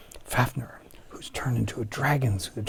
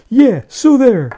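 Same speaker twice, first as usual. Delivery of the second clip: slow, loud, high so there; gloating jeer, pitched way up; loud high taunt; 12 semitones higher, 14 dB louder, slower than usual. Acted. Fricka's taunt in story.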